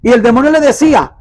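normal talking voice

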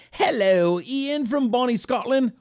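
high-pitched, hammed-up Scottish greeting; bad Scottish accent. Host greeting Ian from Scotland, bad Scottish accent. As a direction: greet them in a terrible mock Scottish accent. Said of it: "Worst accent ever".

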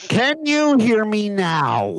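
loud, slow, carrying. Real, not acted. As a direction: speak up so the mic catches it. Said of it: "I only heard half of that".

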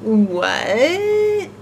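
drawn-out puzzled "What?" in William's voice; a long, confused "What?". Shown as a demonstration. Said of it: "I should have done the William voice".

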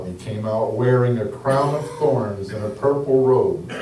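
normal talking voice

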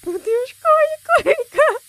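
being chased. Real, not acted. Player being chased in game.

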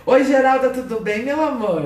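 high, bright-pitched hello to a viewer; cheery high live-stream greeting; sing-song greeting. Real, not acted.

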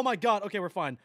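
usual speaking voice

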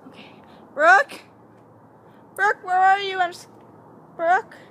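loud, high, rising call. Pretend. Vlogger searching outside for Brooke, calling out. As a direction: shout the name into the dark.

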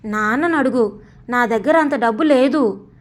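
plain reading voice

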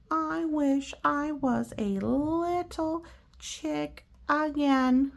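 wistful pigeon. Acted. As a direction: stretch out little itty bitty wistfully. Pigeon wishing to be chick.

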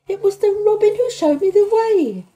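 high, loud, stubborn protest; obstinate protest. A narrator's reading. Girl defending herself to angry old gardener, obstinate protest. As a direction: protest it obstinately, voice pitched right up. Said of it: "she protested obstinately".

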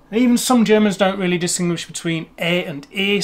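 ordinary talk

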